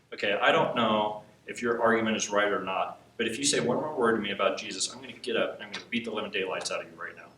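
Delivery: low, controlled mock threat; retold threat. Brother threatens him in retold argument.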